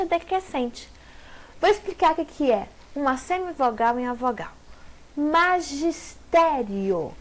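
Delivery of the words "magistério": "Magistério" stretched slowly syllable by syllable; slowly spelled out. Shown as a demonstration. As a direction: draw out "Magistério" to show the glide. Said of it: "Nós temos o quê?".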